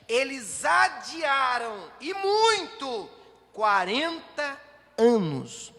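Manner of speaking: loud, slow, drawn-out preaching; preaching at full force. Acted. Pastor to congregation, loud slow preaching. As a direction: hammer home the forty years of delay.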